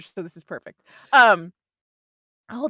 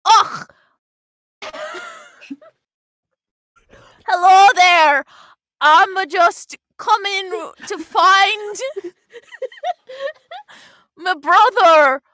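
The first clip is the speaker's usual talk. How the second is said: loud, put-on Scottish lilt, drawn out in places; a bashful character hamming up a Scottish accent through laughter; bad dwarven Scottish voice; attempted Scottish accent; 6 semitones higher, 11 dB louder, much slower than usual. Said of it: "Like the Scottish, slightly".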